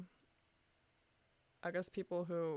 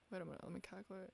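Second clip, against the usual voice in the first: muttering fast; 9 dB quieter, much faster than usual. Real, not acted. Muttering while calculating.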